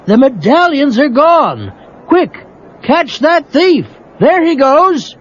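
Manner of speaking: high, urgent judge voice; alarmed judge. Acted. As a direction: raise the alarm about the thief.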